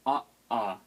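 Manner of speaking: "ah, ah" cut apart by a glottal stop; two separate sounds. Shown as a demonstration. Teacher showing a glottal stop, "ah, ah" separated. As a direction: stop the breath between "ah" and "ah". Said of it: "you make two separate sounds".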